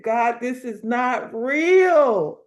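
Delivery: loud, drawn-out mock cry; retold tearful disbelief. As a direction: stretch the last word in overwhelmed disbelief. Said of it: "she just bust out crying".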